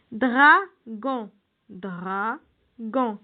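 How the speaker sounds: "Dragon" split dra, pause, gon, twice; syllable by syllable. Shown as a demonstration. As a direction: sound out "Dragon" slowly in two syllables. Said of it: "combien de syllabes". (« Dragon » découpé lentement en deux syllabes)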